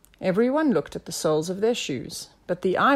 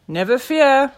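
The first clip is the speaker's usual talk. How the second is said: loud, raised reassuring call; sidekick's confident call; 7 semitones higher, 8 dB louder than usual. A narrator's reading. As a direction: call out to the rescue. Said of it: "called Nelson".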